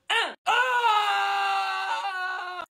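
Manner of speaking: shrill panicked scream; high scream. Acted. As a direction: scream in horror.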